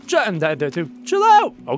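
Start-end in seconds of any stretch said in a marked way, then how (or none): 1.1-1.5 very high, loud shout; high yell. Real, not acted. Yelling at an attacker in the game, very high loud shout. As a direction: shout him down in real alarm.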